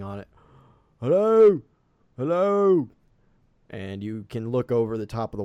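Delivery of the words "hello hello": high, loud hail at the gate; calling out. Acted. Captain hailing villagers at a closed gate, calling out. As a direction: holler to whoever is inside. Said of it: "the captain comes up and he starts banging".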